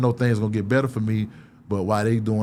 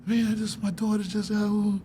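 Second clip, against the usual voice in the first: higher, slow plaintive voice; a drawn-out, high moan of trouble; venting complaint; 10 semitones higher, 3 dB quieter than usual. Retold or imitated. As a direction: pour it out in a high, slow whine. Acting a man venting his troubles.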